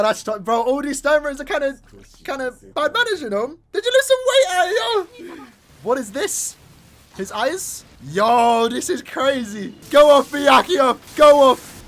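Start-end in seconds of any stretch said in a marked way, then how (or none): 3.7-5.1 very high, loud astonished question; incredulous. Real, not acted. Viewer baffled by a character's look, squealing in surprise. 9.9-11.8 loud, raised shouts of encouragement; hyped cheering. Real, not acted. Fan egging Byakuya on, shouting with excitement.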